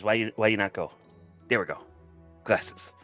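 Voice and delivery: normal talking voice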